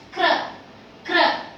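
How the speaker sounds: "kr" with k running straight into r; K before R. Shown as a demonstration. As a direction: sound the k first, then r, in "kr". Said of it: "I have the ka first".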